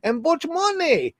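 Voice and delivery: very high mock Chinese official, broken English. Government official bargaining with the network, high mock accent. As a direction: haggle in a high put-on foreign accent. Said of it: "Just say".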